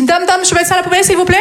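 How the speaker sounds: high, rapid-fire schoolkid; mock pupil pestering the teacher; interrupting pupil. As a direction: butt in like an eager Marseille pupil, fast and high. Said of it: "j'ai un élève qui m'interrompt".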